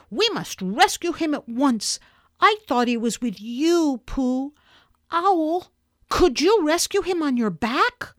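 put-on high, pressing boy's voice; urgent young rescuer. Boy asks Owl to rescue Piglet, urgent and high. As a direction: appeal urgently for help.

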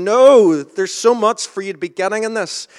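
loud, high outburst on the opening word; emphatic objection. Acted. Preacher voices the Father objecting to Peter, loud and emphatic. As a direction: cut in forcefully, voice leaping up.

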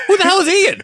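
cracking up mid-question, high and loud; laughing disbelief. Retold or imitated. Retelling the budget meeting and breaking up laughing. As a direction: squeal it through laughter.

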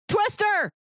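exclaimed movie title with a punch; announcing. Real, not acted. Host pitches a movie title to her co-host, announcing. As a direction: announce it like it has an exclamation mark.

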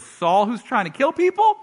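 can't believe what he's hearing; shocked disbelief. Acted. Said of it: "And he goes".

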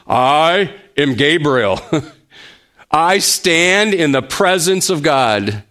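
slow, weighty "I am Gabriel", each word given full weight; preacher voicing Gabriel's answer to Zechariah, emphatic; emphatic declaration. Measured and solemn, with a small laugh before the second phrase. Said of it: "that same emphatic expression".

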